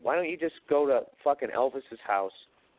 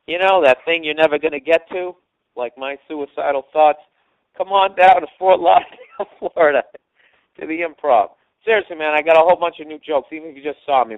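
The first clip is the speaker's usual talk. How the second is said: loud, higher hyped pitch; club plug; 5 semitones higher, 11 dB louder than usual. Real, not acted. Comic hyping his Fort Lauderdale show, loud and high. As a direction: sell the gig hard. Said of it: "hyped plug for the show".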